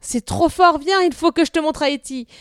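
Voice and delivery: put-on a boy who can't contain his joy; thrilled boy. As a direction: burst out with excitement. Boy thrilled to show horse.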